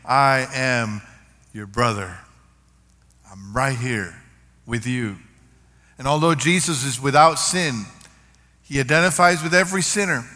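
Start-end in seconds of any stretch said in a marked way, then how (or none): 0.0-5.2 tender. Retold or imitated. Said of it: "he's virtually saying".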